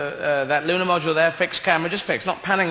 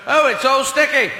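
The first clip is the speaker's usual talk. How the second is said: mock disgusted astronaut; 7 semitones higher, 4 dB louder than usual. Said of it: "can't get out onto the moon and go".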